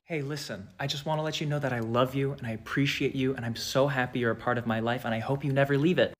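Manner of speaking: soft, low sincere; sweet plain eyes. Shown as a demonstration. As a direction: say it kindly, eyes normal. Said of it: "it looks malicious".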